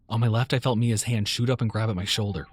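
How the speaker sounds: plain reading voice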